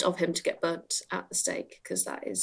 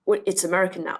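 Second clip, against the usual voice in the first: usual speaking voice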